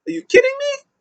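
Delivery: squeaky high, disbelieving outburst; Kalisto mock outrage. Kalisto, mad at the match result, in a squeaky high mock outburst. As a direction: shriek it like an outraged wrestler. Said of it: "Kalisto got mad".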